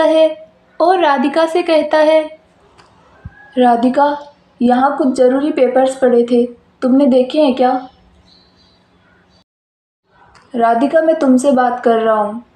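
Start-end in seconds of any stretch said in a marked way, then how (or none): 3.6-12.4 lowered male voice, asking; a husband voiced low, a little impatient; husband's voice. Husband asks his upset wife about papers, lowered male voice.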